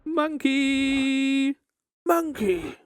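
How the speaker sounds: high excited calls of "Monkey!"; excited yelling. Real, not acted. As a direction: call out the monkey, thrilled. Monkeys appear in game.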